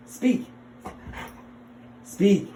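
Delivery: high, loud command to the dog; firm dog command. Real, not acted. Commanding dog, louder each time.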